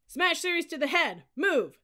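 high, loud drill command; yelled. A narrator's reading. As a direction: yell the order from the stand. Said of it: "yelled the muscle-bound upperclassman".

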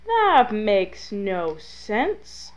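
very slow, stretched-out disbelief; drawn-out exasperation. Real, not acted. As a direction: drag every word out in exasperation. Repeats complaint, more exasperated.

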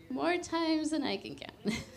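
wry laughing aside; laughing confession. Real, not acted. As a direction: admit it laughing.

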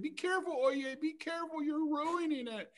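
high, anxious warning, slowing at the end; worried warning. Acted. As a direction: warn Oye in a high voice. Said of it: "They said".

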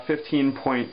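normal talking voice

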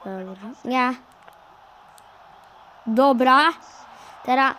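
ordinary delivery

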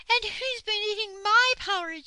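very high, loud, indignant bear; shrieked outrage as Mother Bear; angry Mother Bear. A narrator's reading. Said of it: "said Mother Bear angrily".

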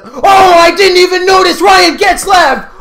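high, loud shout of disbelief; very excited. Real, not acted. Gamer spotting a rare card in the pack, very excited. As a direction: yell it out as a huge surprise lands. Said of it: "I got very excited".

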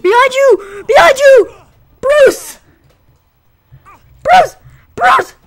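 high, loud panicked warnings; frantic shouting. Real, not acted. Gamer yelling to in-game ally Bruce, frantic shouting. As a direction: yell it like his life depends on it.

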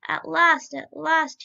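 loud, high cry of relief; jubilant, ringing outburst without actual laughter; joyful cry. A narrator's reading. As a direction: exult loudly, leaning on each repeat. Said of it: "he cried, laughing joyfully".

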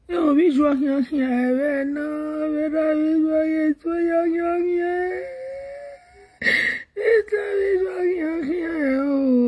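long, sustained mourning cries; acted grief-stricken keening; hammed-up wailing. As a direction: wail it out, stretching every ending. Acted wailing over bad news.

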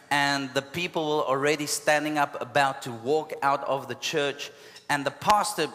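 ordinary delivery

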